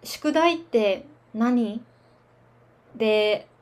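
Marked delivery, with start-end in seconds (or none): 0.0-1.8 slow demo. Shown as a demonstration. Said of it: "example sentence demo".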